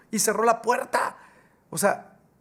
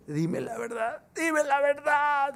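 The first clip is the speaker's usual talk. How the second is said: much higher, slow, tearful plea; pleading in tears; 8 semitones higher, much slower than usual. Acted. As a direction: beg for the truth, about to cry. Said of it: "Y empieza a llorar". (súplica llorosa, aguda y lenta)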